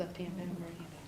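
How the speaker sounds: whispered prompt under breath; whispering. Real, not acted. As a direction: quietly coach the oath gesture. Quietly prompting during swearing in.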